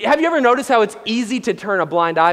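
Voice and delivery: ordinary talk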